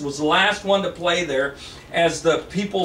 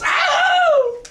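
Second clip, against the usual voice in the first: sudden high yelp of pain, louder; sharp cry of pain; 5 dB louder than usual. Acted. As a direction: yelp as glass slices the leg. She cuts leg on bottle.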